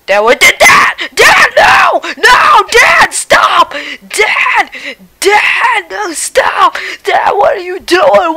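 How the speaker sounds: sobbing, screaming at full volume; crying shout. Acted. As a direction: scream and wail in panic. Son screams as dad wrecks game.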